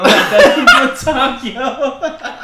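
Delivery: high, loud, laughing through the words; real laughter breaking up the sentence; cracking up. Real, not acted. Cracking up at own fake accent.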